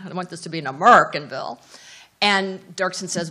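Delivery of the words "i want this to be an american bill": put-on quick Texas accent, AMERICAN punched loud as "A-murr-kin"; brisk mock-Texan line, stress on AMERICAN; Lyndon Johnson. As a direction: imitate a Texan drawing AMERICAN into "A-MURR-kin", hit hard. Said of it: "or as in his accent".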